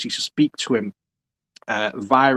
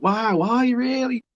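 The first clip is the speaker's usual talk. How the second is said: much higher, starstruck wonder; mock amazed; 11 semitones higher than usual. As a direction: gasp it like a dazzled fan. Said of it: "I'm like".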